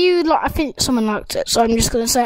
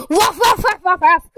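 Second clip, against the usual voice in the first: high, rapid yapping barks; dog barking; 5 semitones higher than usual. Acted. Gamer mimics the dog for viewers, high quick barking. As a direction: yap like an excited little dog. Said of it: "you probably can hear my dog".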